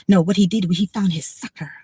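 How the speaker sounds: unvoiced conspiratorial whisper. Telling viewers about landlord, whispering. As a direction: lean in and whisper the secret.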